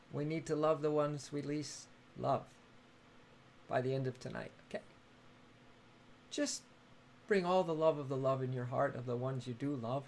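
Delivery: low, soft and unhurried, easing listeners toward sleep; soft bedtime guidance. Acted. Livestream host gently guiding viewers before sleep, softly. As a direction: tuck them in with gentle love talk.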